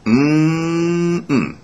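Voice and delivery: long, high, held "mmm"; savouring hum. Pretend. Receptionist selling stupid pudding to Mister Blair, savouring hum. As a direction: hum temptingly with hammed-up relish.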